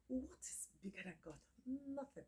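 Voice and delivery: soft, whispered rhetorical question; hushed whisper. Real, not acted. Repeats the question in awe.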